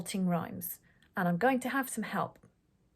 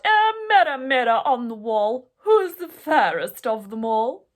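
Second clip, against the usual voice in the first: queen shouting; 8 dB louder than usual. A narrator's reading. The spoiled queen shouting at her mirror. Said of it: "the spoiled and stupid queen would shout".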